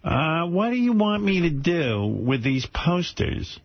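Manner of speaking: high, very slow mom voice; imitating his mother. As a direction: drag it out like an elderly mother. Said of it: "I could do an impression of my mother".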